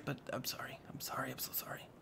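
mock-sheepish whispered sorry; whispered apology. Real, not acted. Apologising to viewers for the uneven build, whispered. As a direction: whisper the apology under your breath.

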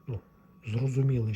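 ordinary talk

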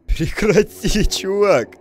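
high, loud, drawn-out scared plea; laughing in fright, slow and loud; panicking gamer. Real, not acted. Startled gamer yells at game. (паникующий игрок)

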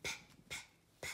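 "P" as a voiceless puff, not "pee"; phonics P sound. Shown as a demonstration. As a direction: puff out "P, p, p" as three tiny pops.

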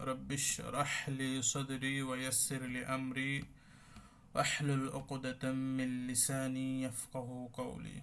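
slow, chanted Arabic prayer; slow dua recitation. Acted. Teacher opening class with a slow prayer. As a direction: intone the dua slowly, each word drawn out.

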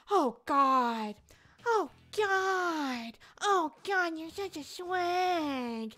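a hint of a breathy British starlet, tried and half-failed; baby voice Jennifer Coolidge. Streamer attempts a donor's requested voice, mock British baby voice. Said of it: "baby voice Jennifer Coolidge calling me a slag".

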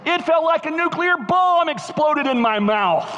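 high, strained storyteller's outburst; dramatic exclamation. Retold or imitated. Preacher tells church about the pepper burning, high dramatic exclamation.

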